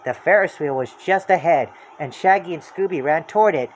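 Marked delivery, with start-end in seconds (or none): none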